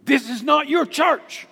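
put-on sharp, loud, high rebuke from an old lady; angry. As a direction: scold him like she owns the place. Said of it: "very angrily she said".

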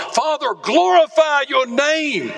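high, fervent plea to the Father; preacher crying out Jesus' prayer. Retold or imitated. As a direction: lift it up as a heartfelt cry. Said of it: "He cries to the Father".

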